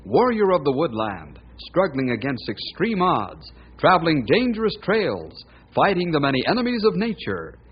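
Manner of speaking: punchy announcer voice over music; announcer intro. Acted. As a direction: sell the hero's adventures like an old radio announcer. Radio show opening announcement.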